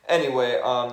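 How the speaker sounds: ordinary delivery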